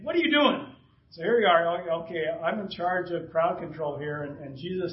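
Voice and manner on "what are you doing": high-pitched indignant challenge, acted. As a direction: demand it in mock outrage. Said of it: "he's indignant".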